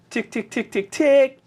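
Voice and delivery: quick little key-tapping noises; imitated typing ticks. Onlooker miming someone typing their password, imitated key ticks. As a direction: tick out each keystroke with your mouth.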